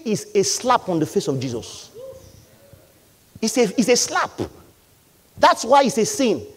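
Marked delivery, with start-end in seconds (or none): none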